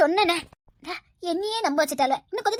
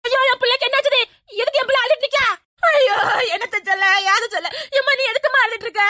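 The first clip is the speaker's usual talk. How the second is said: higher, louder fretful mother; a mother fussing over her child; mock alarmed wailing; 6 semitones higher, 5 dB louder than usual. Mother fussing over her crying daughter, loud high wail.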